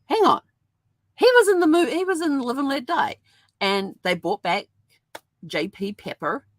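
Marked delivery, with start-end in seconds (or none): 0.0-3.2 high, loud, startled hang on; a sudden jolt of recognition; surprised realisation. Retold or imitated. Fan watching the Bond film, spotting the returning sheriff, much higher and louder with surprise. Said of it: "I'm like".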